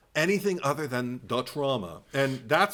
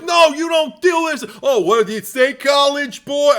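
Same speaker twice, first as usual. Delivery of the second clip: much higher, louder cartoon Brooklyn accent, taunting; mock Brooklyn tough; 12 semitones higher, 11 dB louder than usual. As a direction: sneer it like a Brooklyn street tough. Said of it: "Why is he all from Brooklyn sitting there like".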